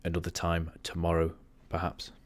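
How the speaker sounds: usual speaking voice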